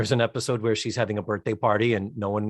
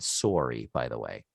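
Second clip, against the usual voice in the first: low, softer Wisconsin "Sorry"; Wisconsin accent; 14 semitones lower, 7 dB quieter than usual. Shown as a demonstration. Pointing out how the clerk said it, Wisconsin accent. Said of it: "He's from Wisconsin also".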